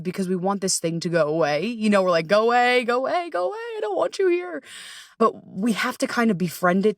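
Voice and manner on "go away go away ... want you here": high whiny pleading to the anxiety; mock pleading. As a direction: beg it to leave in a high whine. Quoting herself begging anxiety away.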